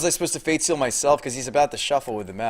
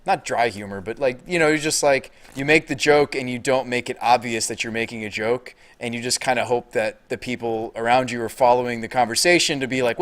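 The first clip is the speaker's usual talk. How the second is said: normal talking voice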